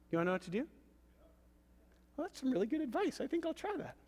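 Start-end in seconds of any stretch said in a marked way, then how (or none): 2.1-4.0 high, soft, fast light voice; mock believer. Pastor voicing a meek listener, high and soft. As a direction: play a meek listener trying it.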